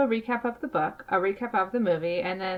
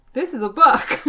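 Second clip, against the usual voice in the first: laughing; 5 semitones higher, 7 dB louder than usual. Real, not acted. Dodging the title she cannot pronounce.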